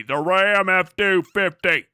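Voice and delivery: slow, loud truck-ad voice; mock ad announcer. Mocking the ad, slow loud announcer voice. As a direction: sell it like a truck commercial.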